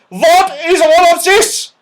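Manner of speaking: put-on high, loud furious old dame; fierce loud snap. A narrator's reading. Cross dame catching naughty pixies, fierce and loud. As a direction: snap it out to scare the room. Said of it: "she snapped loudly in a very fierce voice".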